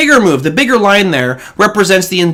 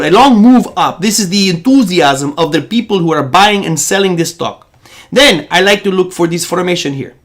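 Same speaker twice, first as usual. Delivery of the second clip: put-on Egyptian accent. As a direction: imitate an Egyptian coworker teaching with conviction. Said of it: "I wanna do it in his accent".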